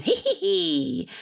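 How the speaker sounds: high gleeful witch's cackle. Acted. Witch grinning on her broom, high cackle. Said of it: "how the witch grinned".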